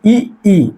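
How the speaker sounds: ई, ई said slowly, tongue toward the palate; vowel demo. Shown as a demonstration. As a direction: demonstrate ई slowly and clearly. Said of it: "इसका उच्चारण करिए आप". (ई को धीरे और साफ़ बोलकर दिखाना)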